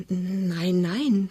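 soft, stammered denial; fearful whisper. Acted. Suitor denies it to angry mother, fearfully hushed. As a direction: shrink back and stammer it quietly. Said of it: "flüstert Pinneberg angstvoll".

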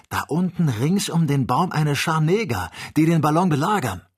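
loud, high, urgent cry; alarmed report. A narrator's reading. Hunter reporting a siege of the balloon, alarmed. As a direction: call out the danger loudly.

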